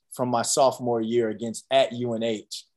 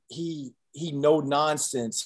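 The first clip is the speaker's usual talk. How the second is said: ordinary delivery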